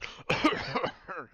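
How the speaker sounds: loud, high spluttering cough; explosive mock splutter; acted coughing fit. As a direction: cough as if choking on a drink. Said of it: "kinda coughs against the table".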